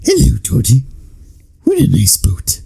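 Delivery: creepy greeting sinking into a whisper; Bill Skarsgård Pennywise. Retold or imitated. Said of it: "Bill Skarsgård is just like".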